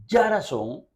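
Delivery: ya razón with YA punched out; stress on first syllable. Retold or imitated. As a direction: hit the opening YA of ya razón hard. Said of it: "que pone".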